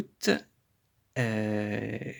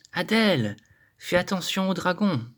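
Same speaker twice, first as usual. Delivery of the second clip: raised, higher call to Adèle; calling a warning; 6 semitones higher than usual. Shown as a demonstration. Teacher calling out a warning to Adèle, raised voice.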